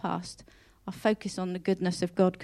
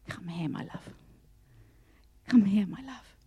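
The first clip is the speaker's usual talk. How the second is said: tender whisper. Retold or imitated. God calling a struggling believer back, tender whisper. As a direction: whisper it like a loving call home. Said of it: "God's just saying".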